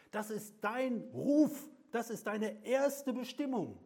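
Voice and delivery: langsam und laut ausgerufen; laute Predigt. Acted. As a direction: schleudere der Gemeinde ihre Bestimmung entgegen. (Prediger ruft laut und langsam die Bestimmung aus)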